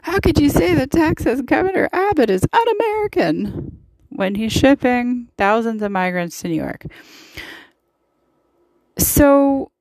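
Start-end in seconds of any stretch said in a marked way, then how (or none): 0.0-3.8 much higher, shrill mock outrage; hammed-up outraged voice; mock outraged Republican. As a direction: parody a Republican pundit clutching pearls. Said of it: "had a cow over".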